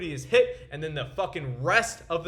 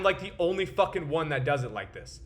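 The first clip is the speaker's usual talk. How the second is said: ordinary talk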